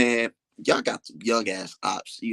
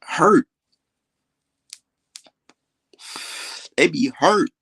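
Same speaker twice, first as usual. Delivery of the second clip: mocking; 6 semitones higher, 5 dB louder, slower than usual. Mocking hurt opponents.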